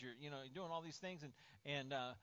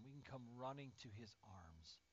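ordinary talk

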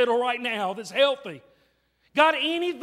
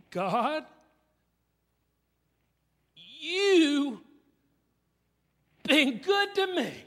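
lightly voiced young man, higher and slow; the invalid boy; 4 semitones higher, much slower than usual. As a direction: gently imitate the paralyzed boy's halting thanks. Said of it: "here's what he said".

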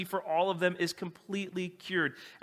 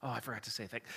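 low, much softer, embarrassed mumble; sheepish admission; 7 semitones lower, 10 dB quieter, faster than usual. Retold or imitated. Husband sheepishly admits to his wife, low and soft. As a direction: own up meekly.

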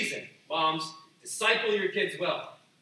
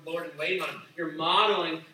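usual speaking voice

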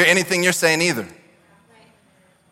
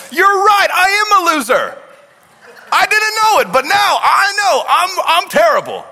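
mock convinced victim; 12 semitones higher, 4 dB louder than usual. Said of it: "Did all of a sudden you're like".

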